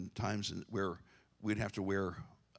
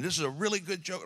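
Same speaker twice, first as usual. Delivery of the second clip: high, hammed-up boast of a good joke; mock proud preacher; 8 semitones higher than usual. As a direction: pastor teasing a friend who bragged about his joke, high mock boast. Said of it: "You were up here going".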